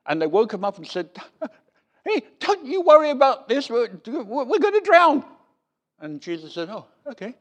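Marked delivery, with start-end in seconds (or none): 2.0-5.2 high, loud, hammed-up panic with a laugh; panicked disciples. Disciples waking Jesus in the storm, mock-panicked and loud. 6.7-7.3 soft, high, casual shrug of a reply; unbothered Jesus. Retold or imitated. Jesus answering the terrified disciples, softly unbothered.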